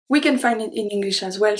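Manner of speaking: ordinary delivery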